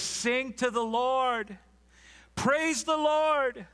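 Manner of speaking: high, drawn-out cry of praise; exultant praise. Acted. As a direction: proclaim it like a jubilant hymn, slow and ringing. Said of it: "into actual song".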